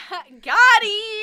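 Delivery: excited. Real, not acted. Triumphant laughing call.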